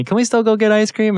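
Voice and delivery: put-on high, loud little-boy voice asking hopefully; pleading kid voice; child's plea. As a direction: imitate a six-year-old begging for ice cream. Father voices son asking for more.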